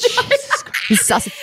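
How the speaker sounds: high squeal breaking into laughter; laughing squeal. Real, not acted. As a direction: shriek through the laugh. Laughing shocked exclamation.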